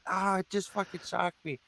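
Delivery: insisting it really shocked him; hammed-up hurt bandmate; mock shocked guitarist. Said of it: "He's like".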